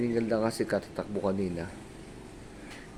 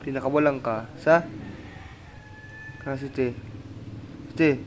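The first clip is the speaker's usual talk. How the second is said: lightly raised sing-song voice for a pet; 5 semitones higher than usual. Real, not acted. Owner cooing to her dog Sweetie, in sing-song baby talk.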